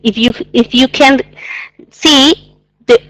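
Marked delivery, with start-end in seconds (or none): none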